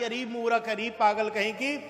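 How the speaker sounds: normal talking voice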